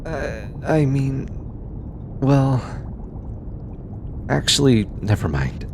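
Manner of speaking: hesitant, halting start that drops away; stumble, then back out awkwardly; flustered backtracking. Acted. Scientist retracting an idea to bodyguard, flustered.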